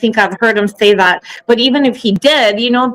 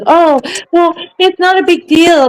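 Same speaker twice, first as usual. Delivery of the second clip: mocking quote; 7 semitones higher than usual.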